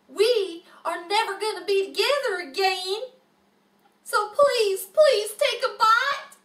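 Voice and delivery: pleading. Acted.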